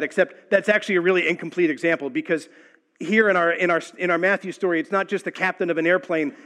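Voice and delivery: ordinary talk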